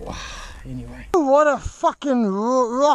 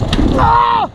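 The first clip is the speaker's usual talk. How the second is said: pained yell; 14 semitones higher, 4 dB louder, slower than usual. Real, not acted. Hurt, cursing at pain.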